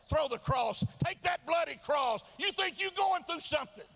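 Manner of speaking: high, loud, building sermon fervour; full-force preaching. Real, not acted. Preacher comparing your trials to the cross, full-force preaching. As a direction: pile up each suffering in a loud, high cry.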